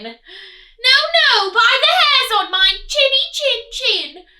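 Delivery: loud, high piggy voice; defiant high piglet; pig refusing. Acted. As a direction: squeal your refusal like a little pig.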